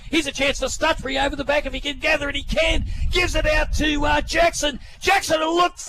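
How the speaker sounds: fast, rising live call; excited call. Real, not acted. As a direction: build the excitement as the ball comes. Live call building to mark.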